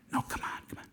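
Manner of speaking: soft coaxing whisper. Acted. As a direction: urge him on under your breath.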